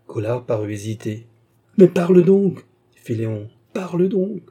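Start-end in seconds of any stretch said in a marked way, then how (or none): 1.8-2.6 loud, high, exasperated outburst; impatient demand. A narrator's reading. Impatient lover demands an answer. 3.7-4.4 high-pitched, pleading insistence; impatient demand. A narrator's reading. Impatient lover repeats his demand.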